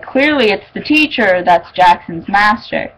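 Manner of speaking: ordinary delivery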